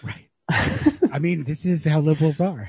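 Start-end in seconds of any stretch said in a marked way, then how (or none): 0.5-1.1 breathy high laugh, no voice; high airy laugh. Real, not acted. Amused by the absurd book, a short high laugh. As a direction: let a short airless laugh slip out.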